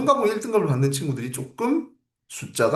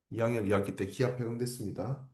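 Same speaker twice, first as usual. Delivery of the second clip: soft echo; 5 semitones lower, 9 dB quieter than usual. Shown as a demonstration. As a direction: repeat it much softer, almost to yourself. Teacher repeats key fact softer.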